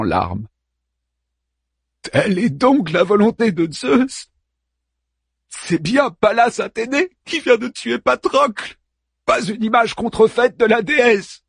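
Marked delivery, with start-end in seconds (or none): 2.0-11.4 higher, strained disbelief; bitter grieving hero. Acted. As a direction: press her for the truth. Achilles questions his mother bitterly.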